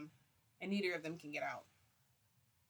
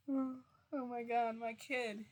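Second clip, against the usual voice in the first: overwhelmed; 5 semitones higher, slower than usual. Real, not acted. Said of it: "overwhelmed by the memory".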